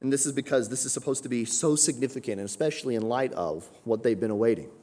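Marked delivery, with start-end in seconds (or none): none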